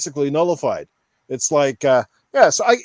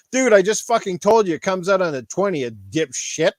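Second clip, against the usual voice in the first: ordinary talk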